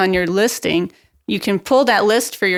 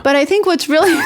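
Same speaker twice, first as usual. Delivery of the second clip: laughing; 9 semitones higher, 4 dB louder than usual. Real, not acted. Breaks into laughter mid-sentence.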